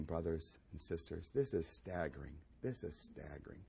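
hushed, awed preacher; hushed awe. Acted. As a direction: let the wonder sink in quietly. Awed low hush.

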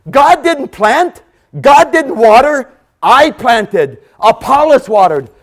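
loud, high and slow, each phrase hammered; slow booming preacher cadence; slow thundering emphasis. Acted. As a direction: proclaim it slowly from the pulpit.

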